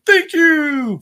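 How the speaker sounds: a loud, high, sing-song thank you; Chad Gable's thank you. Retold or imitated. Recapping the match, imitating Gable's high, loud thank you. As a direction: belt it out like a wrestling heel milking the crowd.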